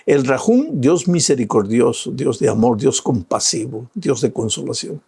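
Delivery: ordinary delivery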